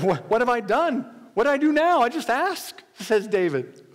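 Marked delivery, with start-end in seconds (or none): 0.0-2.8 higher-pitched, defensive protest; exasperated protest. Acted. David protests to his scolding brother, exasperated. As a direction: protest as if wrongly accused.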